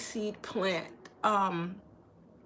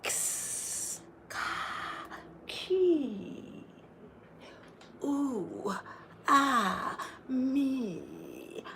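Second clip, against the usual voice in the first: soft whispered kiss sounds, then higher sighs; seductive whispers; 3 semitones higher, 6 dB quieter, much slower than usual. Retold or imitated. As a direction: coo it like sultry temptresses. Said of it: "they're just like".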